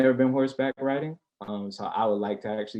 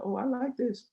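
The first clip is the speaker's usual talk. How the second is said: put-on much higher girly voice; imitating her; 13 semitones higher than usual. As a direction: play the impressed date, high. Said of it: "She'll be like".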